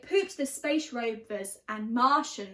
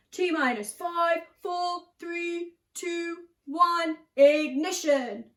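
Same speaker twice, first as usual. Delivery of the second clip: dramatic launch countdown, slowing on ignition; 4 semitones higher, 4 dB louder than usual. Acted. Narrator counting down the rocket launch, building. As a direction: count down to liftoff.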